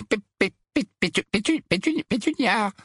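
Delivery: high stuttering attempts at the name; nervous stammer. A narrator's reading. Uncle Vernon trying to reach his wife, nervous stammer. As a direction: trip over the first syllables until it bursts out.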